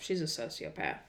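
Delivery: low, soft deadpan; deadpan quiet mock of Sheena; flat low verdict. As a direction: drop it quietly and coldly.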